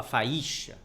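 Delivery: "faísca" said as fa-I-xa, the I stressed as its own syllable. Shown as a demonstration. Teacher showing how not to say faixa, stressed I. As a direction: misplace the force onto the I, fa-Í-xa. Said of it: "Você não fala".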